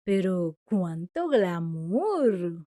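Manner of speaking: exaggerated fake awe; mock admiration. Shown as a demonstration. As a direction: savour glamour as if mocking it. Exclamation example acted.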